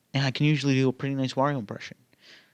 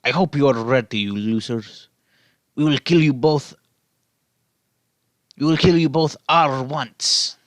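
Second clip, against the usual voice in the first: menacing Wario; 4 dB louder than usual. Acted. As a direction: threaten them like a cartoon brute. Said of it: "Wario cackled".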